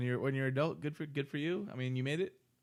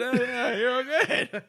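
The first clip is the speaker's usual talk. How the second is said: laughing; 9 semitones higher than usual. Retold or imitated. Imitating cop, laughing.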